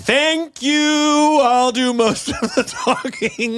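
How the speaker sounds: squeaky send-up of a co-host; high mocking voice. Mocking partner's annoying voice.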